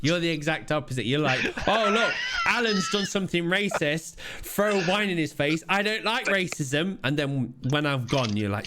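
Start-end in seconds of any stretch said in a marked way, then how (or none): 1.7-7.0 high-pitched imitation of showy disapproval; mock outrage. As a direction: put on a display of righteous outrage. Acting out friend's show of outrage.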